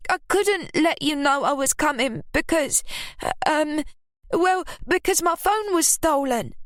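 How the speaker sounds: high, hesitant made-up excuse; evasive. Acted. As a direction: fumble for a lie. Ruby inventing a lie.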